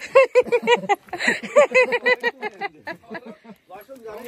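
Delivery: bursting into high, loud laughter. Real, not acted. Friend laughing loudly at the retold scolding. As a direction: laugh out hard at the memory.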